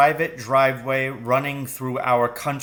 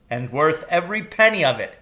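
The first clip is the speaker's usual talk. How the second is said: mild cry; 4 semitones higher, 4 dB louder than usual. A narrator's reading. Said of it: "he cried".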